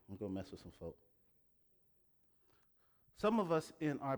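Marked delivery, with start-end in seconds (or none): 0.1-1.0 quiet aside. Real, not acted. Repeated teasing aside.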